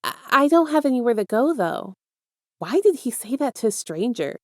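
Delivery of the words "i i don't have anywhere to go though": higher, stammered start; hesitant reply. A narrator's reading. Boy answers the stranger's offer, hesitant.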